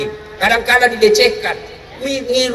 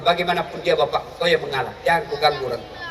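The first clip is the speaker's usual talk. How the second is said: much lower and softer solemn voice; divine voice; 8 semitones lower, 8 dB quieter, faster than usual. Retold or imitated. God answering Ibrahim, low solemn voice. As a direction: speak low and grave as God. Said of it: "Allah berfirman".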